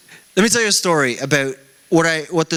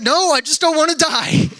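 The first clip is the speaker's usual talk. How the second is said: higher, hammed-up scared wife; mock pleading wife; 12 semitones higher than usual. Wife begging her speeding husband, mock frightened.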